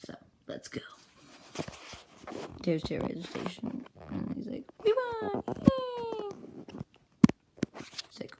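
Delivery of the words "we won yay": very high acted victory cheer; squeaky cheer. Fighter after winning the station, squealed cheer. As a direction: celebrate in a tiny high voice.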